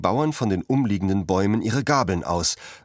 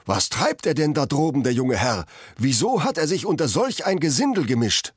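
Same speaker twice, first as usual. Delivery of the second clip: higher, a lightly voiced surprised tenant; tenant calling up; 7 semitones higher than usual. A narrator's reading. Tenant calling up to the young baron, higher and surprised. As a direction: scold the rabble, address the young master.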